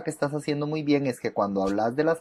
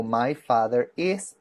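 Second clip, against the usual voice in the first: slow model phrase; slower than usual. Shown as a demonstration. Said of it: "porque estamos hablando de un hombre".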